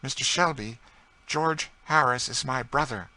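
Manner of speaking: high, loud, proud lady; emphatic, proud announcement; proud declaration. A narrator's reading. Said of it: "lifting her head proudly".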